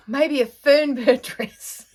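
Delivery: loud and slow, laughing through it; amused laugh breaking into the words; laughing at own joke. Real, not acted. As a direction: giggle out the silly idea. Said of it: "I know I found that amusing".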